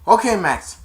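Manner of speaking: loud, high; mock uneasy okay; nervous resignation. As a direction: brace for the ride. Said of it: "He said".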